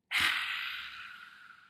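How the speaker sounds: forceful, airy dragon exhale; dragon's breath. Shown as a demonstration. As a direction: let all the fire out in one breath. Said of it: "let's do it together".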